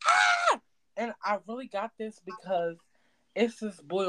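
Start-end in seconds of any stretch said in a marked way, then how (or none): 0.1-0.6 put-on very high squeal back at the baby; screaming back. Godmother screaming back at the toddler, high squeal. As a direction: shriek right back at her. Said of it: "I'm like".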